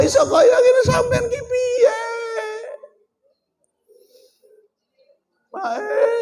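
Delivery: high, crying, almost shouted plea; crying wife. Acted. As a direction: wail it like a desperate wife. Wife pleading with husband. (nangis njerit kaya bojo sing sedhih)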